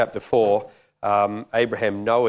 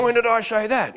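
high-pitched, put-on disbelief; mock indignant protest; 13 semitones higher than usual. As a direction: squawk back in comic denial, pitched way up. Acted indignant reply to God.

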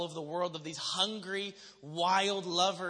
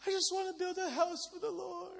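very high, soft tearful squeak; mock crying; 12 semitones higher, 4 dB quieter than usual. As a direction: imitate sobbing through a heartfelt plea. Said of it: "I'm just crying".

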